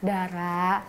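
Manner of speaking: soft, slow, low concerned ask; gentle teacher. Retold or imitated. Teacher softly asking the student what is wrong, slow and soft.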